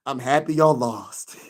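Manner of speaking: slow gloat breaking into a laugh; real gleeful laughing. Real, not acted. Streamer teasing losing Knicks fans, laughing. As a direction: let the last word spill into laughter.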